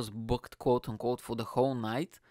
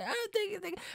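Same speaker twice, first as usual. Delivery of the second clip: very high, small thanks; fake gratitude; 5 dB quieter, much faster than usual. Comedian accepting pity praise, high-pitched fake gratitude. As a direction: squeak out polite mock thanks.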